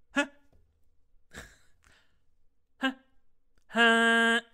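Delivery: loud honk sounds, short then held; imitated car horn. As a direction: toot like a car horn, the last one held. Imitates car horn toots getting longer.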